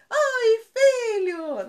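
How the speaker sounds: cumprimento agudo e alto, vogais esticadas; euforia exagerada. Acted. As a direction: festa exagerada ao chegar em casa, bem aguda. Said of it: "chego em casa pros meus filhos e falo". (saudação eufórica, esticada e estridente)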